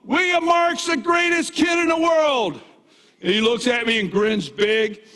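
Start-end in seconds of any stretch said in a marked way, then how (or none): 0.0-2.6 high proud shout with stretched words; joyful yell. Retold or imitated. Grandfather yelling praise to his toddler grandson, high and drawn out. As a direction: holler it out for all to hear. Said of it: "and I yell".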